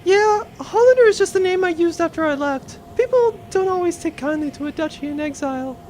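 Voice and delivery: put-on high young woman pilot voice. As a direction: greet an old childhood friend. Pilot explains her hidden identity.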